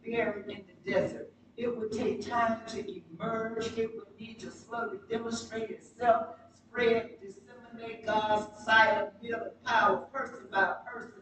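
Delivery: normal talking voice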